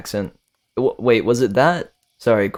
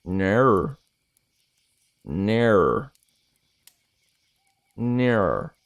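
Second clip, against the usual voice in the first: Australian "Ner", rhyming with air; Australian accent; much slower than usual. Shown as a demonstration. Streamer savouring the Australian word no, Australian accent. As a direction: stretch each "Ner" as an Australian no. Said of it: "instead of no, it's ner".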